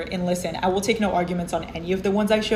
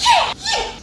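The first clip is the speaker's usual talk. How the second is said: squealed cheer; 4 dB louder than usual. Real, not acted. Celebration squeal.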